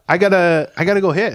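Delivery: imitating a flustered rookie. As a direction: play the nervous new guy ducking reporters. Rookie escaping media scrum.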